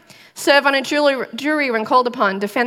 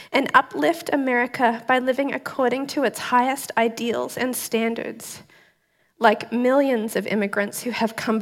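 solemn, measured welcome address read aloud, softer; formal ceremony speech; 3 dB quieter than usual. Acted. New citizen reads the welcome speech to viewers, solemn and moved. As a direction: read it like a judge at a naturalization ceremony, holding back tears.

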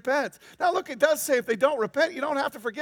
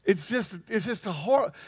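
ordinary talk, nothing marked

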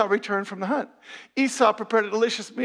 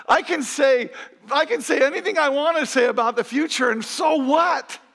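high, loud scoff; incredulous scoffing; 8 semitones higher, 5 dB louder than usual. Real, not acted. Preacher scoffing at claims about the future, loud incredulity. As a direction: throw it out high and loud, dragging the final challenge. Mocks power of mere words.

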